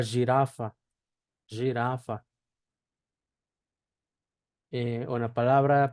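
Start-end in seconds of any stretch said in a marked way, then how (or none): 0.0-2.2 jirafa as "zhi-RA-fa"; Portuguese pronunciation demo. Shown as a demonstration. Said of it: "Por ejemplo, la palabra".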